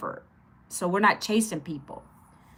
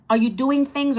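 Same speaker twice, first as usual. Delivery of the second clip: normal talking voice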